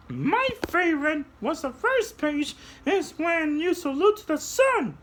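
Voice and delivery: soar up into a tiny high voice; high puppet voice. Acted. Puppet shows kids how to fly, very high voice. Puppet talks about story.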